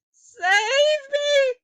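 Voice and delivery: very high, loud terrified plea; cried. A narrator's reading. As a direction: shriek for rescue in terror. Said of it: "cried the wretched witch".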